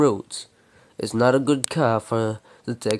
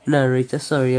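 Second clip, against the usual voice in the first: ordinary delivery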